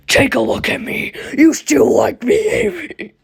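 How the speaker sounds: put-on high, hissing demon child voice; a creepy monster voice, half whispered; demonic Sonic voice. Sonic, now a monster, asks Amy if she still likes him, in a creepy demonic voice. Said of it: "when I keep doing these voices".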